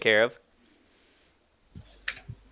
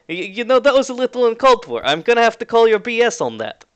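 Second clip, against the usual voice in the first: put-on high-pitched Russian-accented objection; indignant Russian voice, pitched up; 9 semitones higher than usual. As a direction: push back in a Russian accent. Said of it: "a familiar Russian voice".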